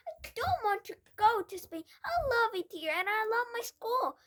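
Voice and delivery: high, loud protest; pleading cry. A narrator's reading. Matilda protesting to her father, high loud plea. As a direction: beg to stay. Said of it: "Matilda cried".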